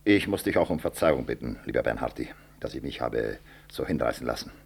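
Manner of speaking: the anger drained, a hushed sorry; quiet apology. Acted.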